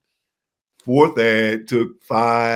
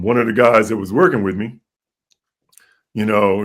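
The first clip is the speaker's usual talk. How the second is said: ordinary delivery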